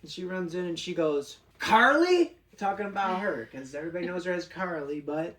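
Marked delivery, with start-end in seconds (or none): 1.6-2.4 high, loud questioning call; calling out a name. Retold or imitated. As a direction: imitate her calling out sharply across the store. Acting out woman storming in, calling.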